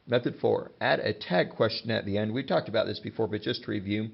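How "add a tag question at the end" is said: ordinary talk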